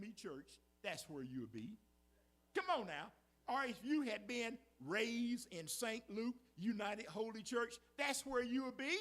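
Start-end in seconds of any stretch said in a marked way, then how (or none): 2.5-9.0 rising, louder and higher preaching call; preaching shout. Real, not acted. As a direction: build it up to a ringing peak.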